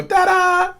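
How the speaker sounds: high-pitched playful reveal; Harley. Retold or imitated. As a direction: imitate a showy ta-da, pitched right up. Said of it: "you hear this Harley go".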